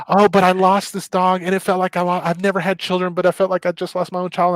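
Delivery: lightly mocking sentimental owner; mocking dog owners. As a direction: parody their overblown grief. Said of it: "people would be like".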